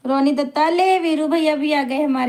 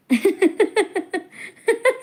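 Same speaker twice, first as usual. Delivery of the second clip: real laugh; 7 semitones higher than usual. Real, not acted. Laughs at reading a comment.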